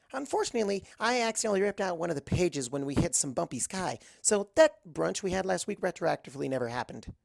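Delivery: very high, fast, bubbly chatter; a bubbly pony chattering to the princess; Pinkie Pie voice. Acted. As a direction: rattle it off like an excited party pony.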